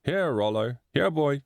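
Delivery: mild cheerful call, little extra volume; cheerful shout. A narrator's reading. Man calling his dog, mild cheerful shout. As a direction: call out lightly, lifting the pitch at the end. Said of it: "a cheerful voice shouted".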